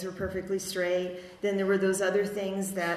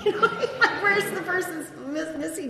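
laughing; 10 semitones higher, 6 dB louder than usual. Real, not acted. Laughing at the missing father.

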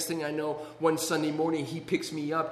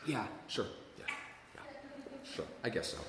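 low, hushed, half-hearted yes; acted-out grudging mumble of assent; reluctant agreement; 4 semitones lower, 15 dB quieter, faster than usual. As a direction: give in quietly with long hesitations. Bored, reluctant agreement, retold.

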